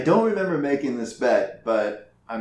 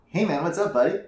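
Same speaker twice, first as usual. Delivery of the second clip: Daryl McGee voice; 5 semitones higher, faster than usual. Retold or imitated. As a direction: greet like the easygoing Daryl. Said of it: "more Daryl McGee voice".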